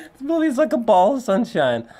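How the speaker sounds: real giggly delight; laughing. Real, not acted. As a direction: laugh as you rave about it. Laughing while gushing about the film.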